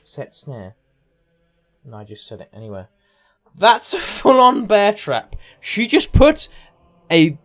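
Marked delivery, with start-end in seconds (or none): 3.6-7.4 loud, slow, high disbelief at the bear trap; amazed outburst. Real, not acted. Streamer to chat, stunned by a bear trap, loud amazed. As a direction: gasp it out, can't believe she did that.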